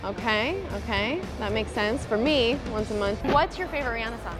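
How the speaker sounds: normal talking voice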